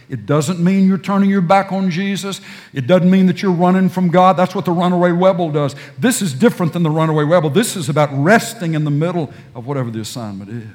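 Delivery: forceful sermon point; emphatic preaching. Acted. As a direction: insist firmly to the crowd.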